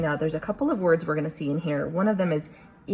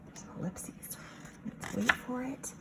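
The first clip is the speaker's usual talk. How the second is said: whisper; 7 semitones higher, 10 dB quieter than usual. Real, not acted. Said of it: "teacher builds suspense".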